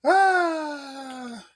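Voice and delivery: high laugh ending in a sighed "ah". Real, not acted. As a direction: laugh it out, then let a high sigh go. Laughs at own retold reaction.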